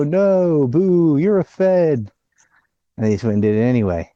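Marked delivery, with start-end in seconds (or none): 0.0-2.1 mock crowd heckle. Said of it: "And people go".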